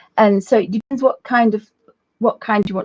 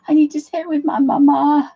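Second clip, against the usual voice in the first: higher, louder needy little pup voice; silly dog voice; 6 semitones higher, 3 dB louder than usual. Acted. Voicing the dog Alfie to viewers, silly needy whine. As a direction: whine it like a clingy puppy. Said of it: "I've been doing this silly voice".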